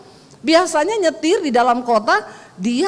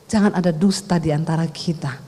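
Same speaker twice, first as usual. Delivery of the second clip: tender vow to husband; 9 semitones lower than usual. Shown as a demonstration. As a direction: say it gently, looking at him.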